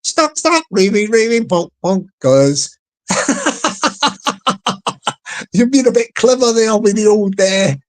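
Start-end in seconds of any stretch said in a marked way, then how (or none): 0.0-2.7 each word said twice, like "Stark, stark", stepping down in pitch; doubled-up words. Shown as a demonstration. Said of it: "you could even double it up".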